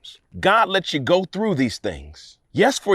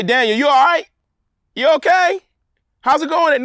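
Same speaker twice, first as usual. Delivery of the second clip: a lightly voiced breezy check-in, pitched higher; mock casual king; 8 semitones higher, 4 dB louder than usual. Preacher mocking what Darius didn't say, light and breezy. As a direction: toss it off like a careless greeting. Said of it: "Darius didn't say".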